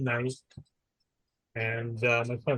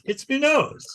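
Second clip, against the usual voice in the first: much higher, a squeaky little "It's me nose"; 11 semitones higher, slower than usual. Retold or imitated. Man with huge nose telling the vet, squeaky voice. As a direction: squeak it out like a silly patient. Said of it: "He goes".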